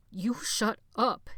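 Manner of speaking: slow breaking voice; voice cracking. A narrator's reading. Jonathan warning Benny, voice cracking. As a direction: let the voice crack as he warns. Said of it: "his voice cracking".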